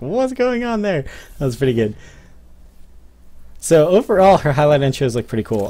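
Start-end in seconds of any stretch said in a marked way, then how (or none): 0.0-1.0 high, baffled cameraman voice; confused cameraman. Retold or imitated. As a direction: play a puzzled cameraman, pitched high. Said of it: "the cameraman is like".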